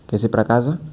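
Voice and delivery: "Que se para casa?" in level, restrained Portuguese tune; Portuguese intonation. Shown as a demonstration. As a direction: ask "Que se para casa?" without big rises or stresses. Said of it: "In Portuguese, you would say".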